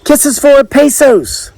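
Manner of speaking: high, drawn-out vendor's call; lovely female voice. A narrator's reading. Island princess selling kisses to the crowd, high lilting call. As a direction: call it sweetly across the way. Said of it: "a lovely female voice came from across the way".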